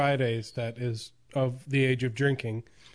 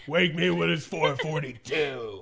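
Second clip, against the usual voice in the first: slow, put-on answer with a laugh; hammed-up, dragged-out bedtime grumble; mock sleepy reply; slower than usual. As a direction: play along as the woken partner, drawn out. Mock answer in the acted exchange.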